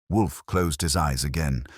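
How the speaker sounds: ordinary delivery, nothing marked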